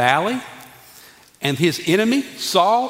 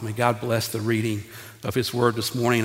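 ordinary talk

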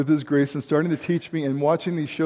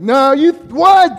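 mock outraged disbelief; 9 semitones higher, 9 dB louder, much slower than usual. Retelling arguing with a teacher.

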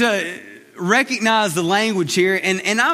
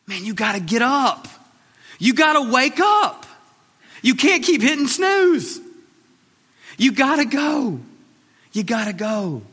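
urgent exhortation; 3 semitones higher than usual. Acted. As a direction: rouse them out of bed with the words. Preacher urging congregation to wake.

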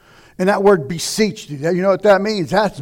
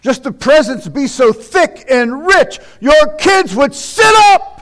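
louder, driving preacher's list; rising preaching; 8 semitones higher, 10 dB louder than usual. Acted. Preacher lists the delivered to the congregation, loud. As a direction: hammer each deliverance home.

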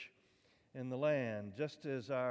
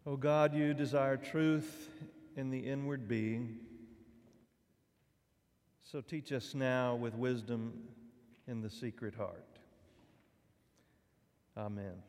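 prayer; 3 dB louder than usual. Real, not acted. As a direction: pray solemnly, each phrase set apart by long pauses. Opening prayer before the sermon.

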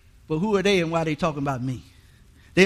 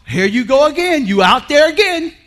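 weary God teasing; 6 semitones higher, 6 dB louder than usual. Retold or imitated. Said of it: "I bet God just gets sick of me".

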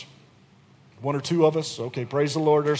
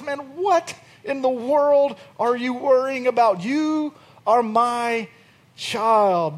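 God tenderly chiding; 5 semitones higher, 3 dB louder, slower than usual. Retold or imitated. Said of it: "God looks at us and says".

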